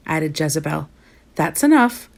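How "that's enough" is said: scolding mother, pitched up; mild scolding. A narrator's reading. Mama stops the girls' teasing, mildly scolding. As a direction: cut the teasing off, higher but not shouted. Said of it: "scolded Mama".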